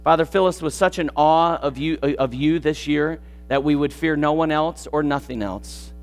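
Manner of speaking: soft, low prayer voice; hushed closing prayer over music. Acted. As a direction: pray it quietly and humbly.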